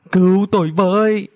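acted panicked plea; cry for help. A narrator's reading. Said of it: "cất tiếng kêu cứu".

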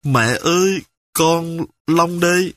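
put-on high, wailing child's cry; a small boy sobbing to his mom on the phone; voiced child crying, lightly; child bursting into tears. A narrator's reading. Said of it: "Long òa khóc".

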